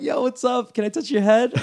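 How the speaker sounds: high-pitched, giddy hello; excited greeting. Retold or imitated. To bald old friend working security, giddy excitement. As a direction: re-enact an eager greeting, voice way up.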